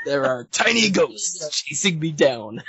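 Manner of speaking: dramatic slow read. Acted. Rereading the line dramatically.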